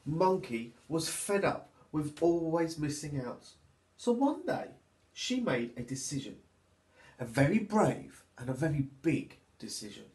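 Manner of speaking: put-on lively, sing-song reading for little children; storybook narration. A narrator's reading. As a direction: read it like storytime for toddlers.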